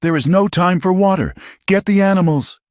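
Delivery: high, urgent shouted orders. A narrator's reading. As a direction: command over the roar. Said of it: "Elara shouted".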